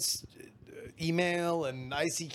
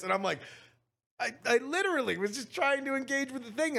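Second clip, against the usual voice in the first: normal talking voice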